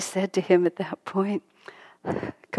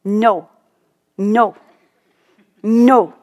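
put-on loud, refusing child's no; louder, rising stubborn refusal; 2 semitones higher, 9 dB louder, much slower than usual. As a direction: whine no like a defiant kid. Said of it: "They're just so unwilling".

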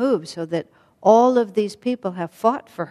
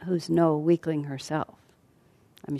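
usual speaking voice

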